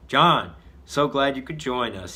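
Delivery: ordinary delivery, nothing marked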